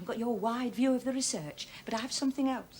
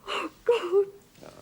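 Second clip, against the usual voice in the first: higher, louder, breaking into tears; crying out; 8 semitones higher, 5 dB louder than usual. Acted. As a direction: cry it out as she breaks.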